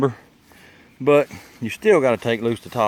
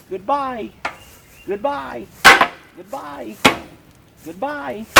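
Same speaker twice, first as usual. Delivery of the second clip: sing-song goodbye; 11 semitones higher, 6 dB louder, slower than usual. Real, not acted. Waving off brake line, sing-song.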